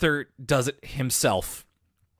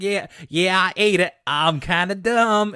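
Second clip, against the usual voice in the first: put-on higher, louder dopey kid voice; imitated dumb kid; 6 semitones higher, 5 dB louder than usual. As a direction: play a kid cheerfully owning up. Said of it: "it's just like".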